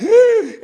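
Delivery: high, loud theatrical gasp; shocked gasp. Retold or imitated. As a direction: gasp like a horrified mother. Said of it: "She goes like".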